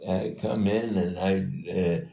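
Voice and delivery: usual speaking voice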